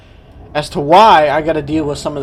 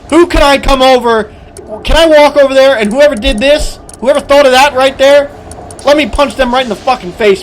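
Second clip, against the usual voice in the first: higher and louder, seething with rage; furious rant; 7 semitones higher, 4 dB louder than usual. Real, not acted. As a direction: threaten the designer at full volume. Ranting, wants to punch the designer.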